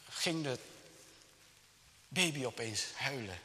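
ordinary talk

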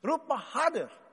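fast, high mocking goad; mocking taunt. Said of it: "En toen zei Elia".

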